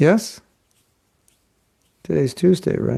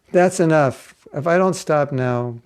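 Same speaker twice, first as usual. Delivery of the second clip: ordinary talk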